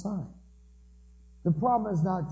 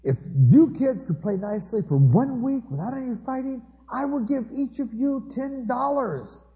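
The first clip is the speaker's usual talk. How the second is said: higher, slow, pleading bribe; desperate offer; 8 semitones higher, slower than usual. Retold or imitated. Desperate dad bribing fighting kids with money, pleading. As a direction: beg them with a frantic promise. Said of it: "desperate moment".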